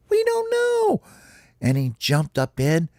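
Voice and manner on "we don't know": very high tiny cartoon voice; squeaky critter reply. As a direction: squeal the answer like little rodents. Homies answer in chorus.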